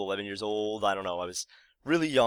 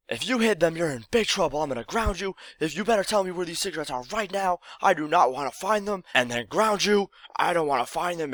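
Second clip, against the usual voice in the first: imitating an angry mom, much higher pitched; raging mom; 8 semitones higher than usual. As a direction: scold the kid as a fed-up mother. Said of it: "she was just raging".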